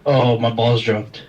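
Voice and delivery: sounding far off in an echoey room; echoing tunnel. Real, not acted. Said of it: "You sound like you're in a tunnel".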